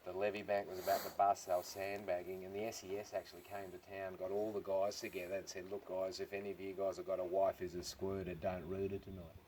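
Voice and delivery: imitating an emotional old bloke, low and very soft; hushed, deadpan solemnity for a tall tale; mock emotional. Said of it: "he got quite emotional".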